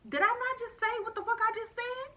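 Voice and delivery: high, loud, disbelieving demand; exasperated anger. Retold or imitated. To the ex ignoring her refusal, high exasperated anger. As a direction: make her hear you've had enough.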